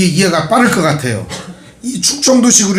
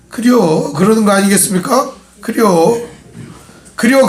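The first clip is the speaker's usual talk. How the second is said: lukewarm Chungcheong reply; 5 semitones higher than usual. Retold or imitated. Pastor mimicking a vague Chungcheong yes-or-no, Chungcheong accent. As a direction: mimic a Chungcheong local who won't commit. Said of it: "충청도 사람들 마냥 이게 뜨뜻미지근하게".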